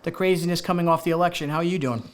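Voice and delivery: ordinary talk, nothing marked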